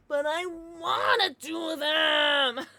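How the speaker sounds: loud, slow bratty wail; mock whine. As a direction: wail like a spoiled kid. Mock whining about drugs.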